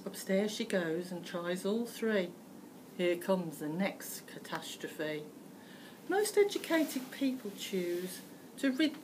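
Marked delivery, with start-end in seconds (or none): none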